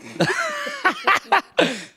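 high burst of laughter. Real, not acted. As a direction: break into a laugh. Contestant laughs at own spelling.

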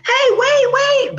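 high, loud protest; indignant yell. Acted. Possum yelling at the animals who ignore him, high and loud. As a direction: shout after them, outraged. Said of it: "He's like".

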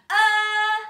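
high, very loud held "Uh"; big sustained shout. Shown as a demonstration. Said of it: "Can you shout it?".

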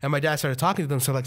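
ordinary talk

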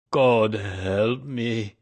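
loud, long-drawn despairing cry; anguished cry. A narrator's reading. Old man facing exposure, slow anguished cry. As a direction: cry out in despair, stretching each word. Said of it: "he cried".

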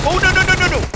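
very high, loud burst of alarm; frantic, rapid squeal of no; panicked yell. Real, not acted. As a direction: cry out in sudden panic. Sudden danger in game.

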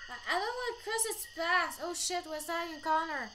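normal talking voice

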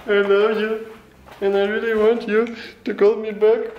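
put-on high loud sobbing girl; crying girl. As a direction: wail it like a crying little girl. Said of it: "видео, где девочка плачет".

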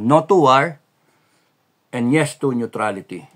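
forceful rallying cry; loud declaration. Real, not acted. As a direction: punch it out loud and firm. Rally declaration against war.

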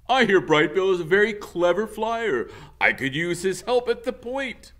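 booming goose leader announcement; commanding goose leader. Acted. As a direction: address the whole flock like a proud leader.

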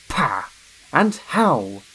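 loud, scornful grunt. A narrator's reading. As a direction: scoff at him. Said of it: "grunted Gordon".

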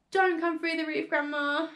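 high, urgent plea; pleading prayer. A narrator's reading. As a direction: plead with her from down below. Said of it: "he prayed".

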